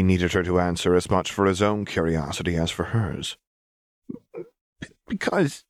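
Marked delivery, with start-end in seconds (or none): 4.3-5.6 put-on high, broken child's stammer; a girl fighting tears; tearful stammer. A narrator's reading. Powder answering Silco through tears, high stammer. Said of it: "tears finally began to flow".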